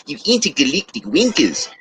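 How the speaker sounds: lightly imitated New Zealand accent, wankers as winkers; a hint of Kiwi, wankers said as winkers. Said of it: "an accent that sounds really funny".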